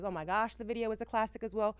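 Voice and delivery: ordinary talk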